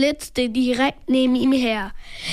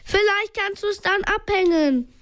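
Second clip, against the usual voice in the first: higher-pitched called suggestion; storyteller's mild shout; calling out; 6 semitones higher than usual. A narrator's reading. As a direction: call advice over, lightly raised. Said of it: "rief Bob".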